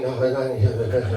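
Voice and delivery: acting winded, low refusals; mock breathless dad; out of breath. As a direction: puff out each word, pretending to be winded. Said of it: "Y'all ever so out of breath".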